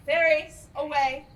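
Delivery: loud, defiant stage refusal; angry refusal. Acted. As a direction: fling the refusal back hard. Titania refuses, dismisses fairies.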